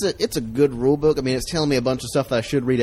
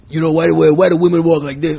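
loud, higher New York comic; put-on New York voice, pitched up; thick New York accent; 4 semitones higher, 8 dB louder than usual. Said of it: "thick New York accent".

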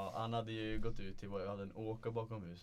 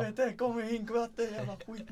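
high, loud frantic shout; dad still screaming for water, high and loud; panicked dad yelling; 12 semitones higher, 6 dB louder than usual. Retold or imitated. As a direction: bellow in sheer panic for a bucket. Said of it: "farsan komma med ren panik".

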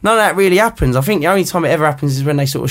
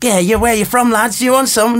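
mock northern football lad, higher and chirpy; northern lad accent; 8 semitones higher than usual. To a podcast host, imitating rowdy away fans in a northern accent. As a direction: put on a northern accent to mimic a rowdy fan. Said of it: "Isn't that a northern accent there?".

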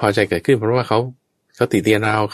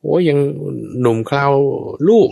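higher, exasperated whining at being bossed; a mock-indignant complaint, pitched up; resentful grumble; 7 semitones higher than usual.

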